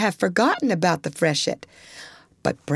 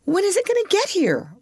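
quavering voice; 13 semitones higher than usual. Acted. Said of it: "in a quavering voice".

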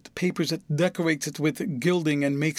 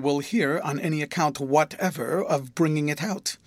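plain reading voice

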